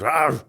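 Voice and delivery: sudden dog "Arr!" thrown into the story; mock dog snarl. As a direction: imitate a dog lunging with a short roar. Acts out his dog attacking.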